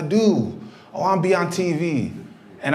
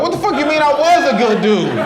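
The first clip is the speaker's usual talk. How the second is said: pitched up, louder, laughing disbelief; retold outrage at a friend, loud and high; incredulous yell; 9 semitones higher, 3 dB louder than usual. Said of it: "I'm like".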